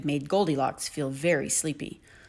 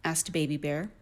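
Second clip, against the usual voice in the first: plain reading voice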